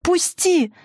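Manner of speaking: high-pitched desperate cry; sharp, high outburst; girl's frightened scream. A narrator's reading. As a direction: cry out to make her let go. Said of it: "закричала Стежка".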